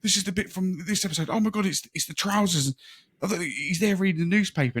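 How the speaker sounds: very high, loud, breathless fan squeal; overexcited gushing. Retold or imitated. Fan touring Wallace and Gromit's house, squealing with excitement. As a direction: gush like a kid who just met his heroes. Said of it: "I don't see how excited someone can get".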